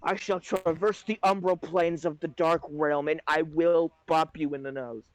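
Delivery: usual speaking voice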